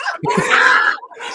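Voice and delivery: high shrieking laugh; loud shrieking laugh. Real, not acted. Laughing at skateboard story.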